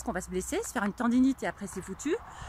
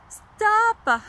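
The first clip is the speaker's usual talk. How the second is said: loud stop; 6 semitones higher, 8 dB louder than usual. Shown as a demonstration. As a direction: stop louder and higher, cutting off. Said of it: "donc stop".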